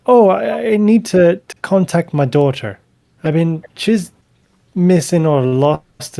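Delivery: high, urgent plea for help; anxious pleading woman. Acted. Hilta begs the cleric to find her daughter, high and urgent. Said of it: "she's like".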